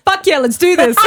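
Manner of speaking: gleeful rally cry, laughing; mock pumped. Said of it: "I'm like".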